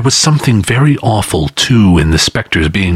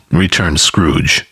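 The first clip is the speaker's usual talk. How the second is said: plain reading voice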